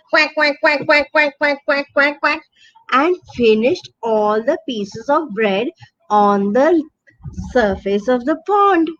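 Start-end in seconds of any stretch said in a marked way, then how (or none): none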